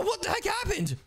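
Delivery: high, shocked outburst; startled exclamation. Pretend. Player startled by the game, high shocked exclamation. Game world suddenly changes.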